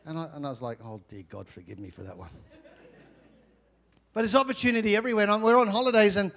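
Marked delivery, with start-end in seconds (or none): 0.8-2.3 low, very soft confessional aside; sheepish repentance. Retold or imitated. Pastor repenting to God after the fish remark, sheepish soft prayer. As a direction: murmur a quick prayer with some amusement.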